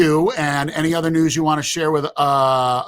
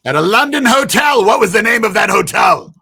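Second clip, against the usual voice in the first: loud; 9 semitones higher, 9 dB louder than usual. Real, not acted. Host shouting trivia question.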